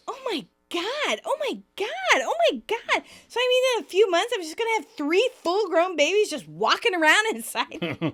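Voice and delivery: freaking out. Retold or imitated. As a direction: panic building over triplets. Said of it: "Phoebe goes".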